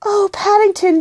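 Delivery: high cry of concern; dismayed exclamation. A narrator's reading. Girl finds bear in trouble.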